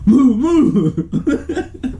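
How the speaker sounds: laughing while shouting. Real, not acted. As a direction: giggle out an order to run. Running from the angry uncle.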